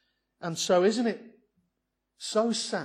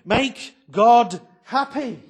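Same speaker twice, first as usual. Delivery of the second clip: loud, slow, pleading urge; people exhorting the priests; 7 semitones higher, 12 dB louder, much slower than usual. Retold or imitated. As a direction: plead with the priests to please God, loud and drawn out. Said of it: "they're saying".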